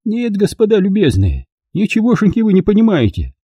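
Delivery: put-on high, proud elderly noblewoman; old lady voice. A narrator's reading. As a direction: speak as a prim dignified old lady.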